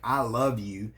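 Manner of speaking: resentful "I love you"; negative tone. Shown as a demonstration. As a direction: spit out "I love you" as if it hurts. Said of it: "with negative body language and a negative tone".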